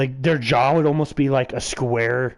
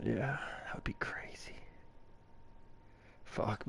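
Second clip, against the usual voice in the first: soft hushed whisper; 20 dB quieter than usual. Real, not acted. Friend whispering fondly about shrooms.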